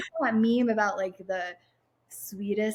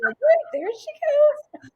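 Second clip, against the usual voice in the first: much higher, louder mock panic; mock alarmed cry; 7 dB louder than usual. Teasing her friend about sinking while swimming, mock alarmed cry. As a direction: squeal it like a friend watching you sink.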